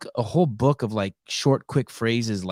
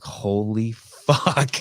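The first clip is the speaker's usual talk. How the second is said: stunned awe; much slower than usual. Retold or imitated. Retelling reaction to fighter's answer.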